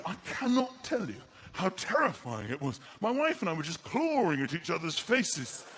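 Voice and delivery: usual speaking voice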